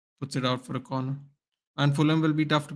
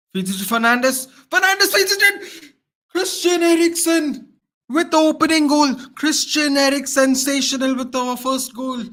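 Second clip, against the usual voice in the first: much higher, louder ecstatic goal call; goal celebration; 12 semitones higher, 6 dB louder than usual. Real, not acted. Fan calling his team's opening goal, ecstatic shout. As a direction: erupt as the opening goal goes in.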